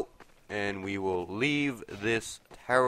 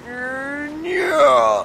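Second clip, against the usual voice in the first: excited whoop; 12 dB louder than usual. Real, not acted. Cheering a jump in game.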